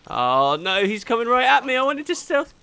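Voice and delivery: rising, loud protest as the plan falls apart; alarmed dismay. Real, not acted. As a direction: pitch and volume climbing in mild panic. Game enemy spots him mid-stealth.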